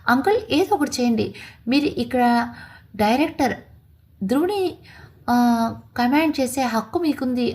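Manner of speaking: plain reading voice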